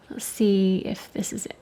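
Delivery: soft whisper to herself. Real, not acted. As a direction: breathe it under your breath. Quietly testing paint, unvoiced.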